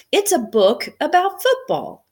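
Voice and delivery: put-on high, loud kid's delighted discovery; amazed boy. Matt finding his football book in the hat, high amazed boy. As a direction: gush like a thrilled boy.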